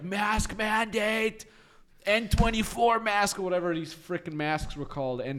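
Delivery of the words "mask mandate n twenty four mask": mock official order. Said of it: "it was like".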